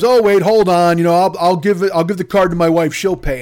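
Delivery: mock panicked customer. Customer backpedaling to the manager, loud and flustered. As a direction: scramble to save the sale. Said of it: "the guy goes".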